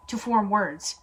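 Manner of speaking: ordinary talk, nothing marked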